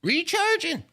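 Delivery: very high squeaky recharging; silly high voice. Pretend. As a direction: squeak it like a toy. High put-on voice.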